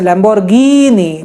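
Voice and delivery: "Lamborghini" with a long stretched final ee. Shown as a demonstration. Teacher stretching the vowel for students, slowly. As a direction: draw out the ee in "Lamborghini". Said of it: "ई की मात्रा ... बड़ी ही रहेगी".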